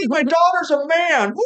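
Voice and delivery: high, slow diva impression. Shown as a demonstration. Comic capping a requested impression, high drawn-out diva voice. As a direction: draw it out high like a pop diva. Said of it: "do a [name] impression".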